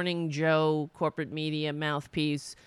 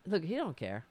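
ordinary talk

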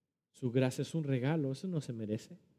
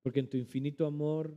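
closing prayer; 2 semitones lower than usual. Acted. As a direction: lead the congregation into prayer gently.

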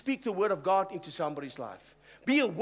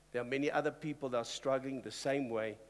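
ordinary talk

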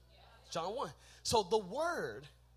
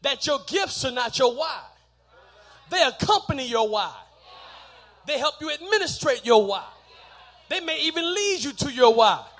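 loud, raised sermon delivery; full-force preaching; 7 semitones higher, 15 dB louder than usual. Acted. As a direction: drive each point home to the congregation.

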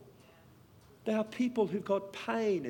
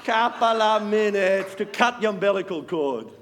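loud, slow, ringing testimony from the pulpit; full-force preaching; 5 semitones higher, 6 dB louder, slower than usual. Acted. Preacher to congregation, hammering out miracle stories at full volume. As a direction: proclaim each miracle to the crowd.

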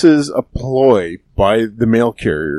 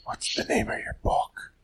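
whisper; 14 dB quieter than usual. Pretend. Pretends not to have heard.

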